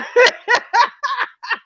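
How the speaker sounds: squealing laugh, high up. Real, not acted. Streamer to chat, squealing with laughter at the trolls.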